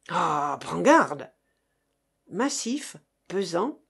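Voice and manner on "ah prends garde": slow threat. Acted. Threat before fight.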